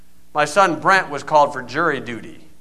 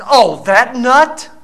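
scoffing; 6 semitones higher, 5 dB louder, much slower than usual. Retold or imitated. Woman scoffs at pastor.

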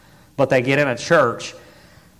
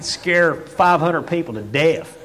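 usual speaking voice

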